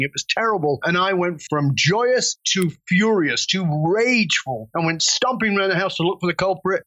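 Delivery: normal talking voice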